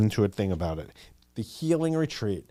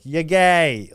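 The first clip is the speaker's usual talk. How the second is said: loud, higher mock yell; imitated screaming; 5 semitones higher, 6 dB louder than usual. High school friend teasing her classmate, mock yell. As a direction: imitate a friend calling it out loudly. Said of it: "she'd be screaming".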